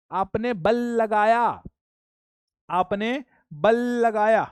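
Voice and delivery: slow, deliberate आपने बल लगाया with weight on बल; teacher drilling the key phrase slowly; slow emphasis. Shown as a demonstration. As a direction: spell it out slowly so it sinks in. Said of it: "क्या बोला".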